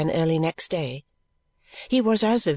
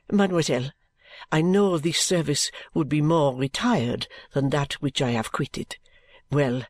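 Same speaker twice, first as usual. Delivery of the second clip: low-pitched, measured French servant; pleading French maid; 5 semitones lower than usual. Acted. French maid asks mademoiselle for a post, low and pleading. Narrator voices applicant pleading.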